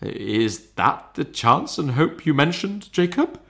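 faltering voice. A narrator's reading. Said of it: "in a faltering voice".

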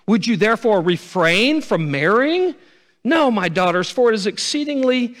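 high, loud anguished plea; Naomi lamenting. Acted. Naomi telling her daughters she is too old, loud anguished plea. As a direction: cry out her hopelessness. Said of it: "She says again".

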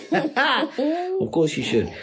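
very high, slow laughing exclamation; laughing squeal. Real, not acted. Reacting to letter, laughing.

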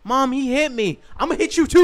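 high, loud hammed-up yell; comic loud child tantrum; mock shouting. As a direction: shout the threat like a brat. Child then threat voiced.

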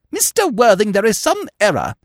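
high, loud protesting governess; flustered governess. Acted. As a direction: push him off in prim alarm. Prism fends off Jack's embrace.

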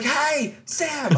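high-pitched hail without much volume; mock yell for a singer's attention; retold calling out. Fan trying to catch the singer's eye, retold calling out. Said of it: "I was trying to yell at him".

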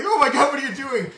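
high, loud panicked yell; losing his mind. Retold or imitated. Avdol watching the gamble from the back, losing his mind. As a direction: yell it in frantic disbelief. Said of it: "Avdol just losing his mind".

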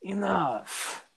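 lightly voiced villain; a hint of a menacing warlord; unearthly voice. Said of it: "and I'll be like".

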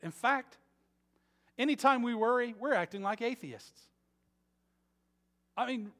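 loud, high preaching at full force; fiery preaching. Acted. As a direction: drive it home to the congregation.

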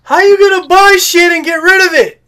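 high, loud outraged yell. Pretend. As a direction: holler in mock disbelief.

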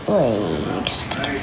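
whispered aside. Real, not acted. Child losing count of books, whispering to self. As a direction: whisper it to yourself.

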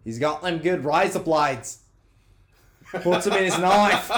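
a joke Aussie line through laughter; mock Australian accent. Joking with friends in a laughing fake Australian accent. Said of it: "really good Australian accent".

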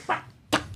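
voiceless, soft spitting noise; mock spitting puffs; imitated spitting. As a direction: spit the sound out as mimicry. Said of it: "he spit on my car".

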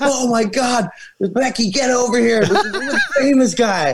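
high, squealing excited fan voice; excited fan girl. Retold or imitated. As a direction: mimic a starstruck girl shrieking. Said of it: "She's like".

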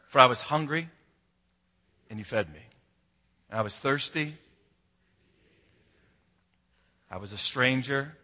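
soft, measured group recital; congregational recitation. Shown as a demonstration. Pastor leading the church in reciting scripture, soft and measured. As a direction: lead them through the verse line by line. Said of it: "quote that scripture with me".

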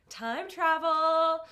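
slow, loud, high-pitched reveal; dramatic announcement. Acted. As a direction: announce it like a movie trailer. Dramatic announcement of time travel.

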